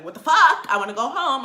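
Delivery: loud, exasperated burst, easing off after; fed-up outburst. Retold or imitated. Tired passenger wanting to go home, fed-up outburst. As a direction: blurt it out loud and high, fed up.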